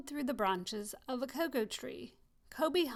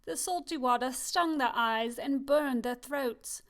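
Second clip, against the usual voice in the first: plain reading voice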